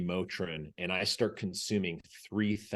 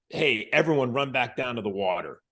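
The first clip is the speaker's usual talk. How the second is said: louder, higher instructor command; barked order; 5 semitones higher, 6 dB louder than usual. Retold or imitated. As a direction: order the class into the water.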